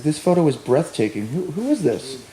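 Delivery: breathy awestruck murmur; softly amazed "who is this"; awed hushed husband. Retold or imitated. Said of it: "he says".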